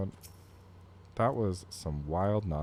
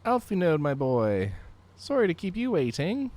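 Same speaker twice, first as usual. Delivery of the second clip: friendly Canadian voice; cheerful Canadian greeting; Canadian accent; 11 semitones higher, 6 dB louder than usual. Acted. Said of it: "I can use a regular Canadian accent for him".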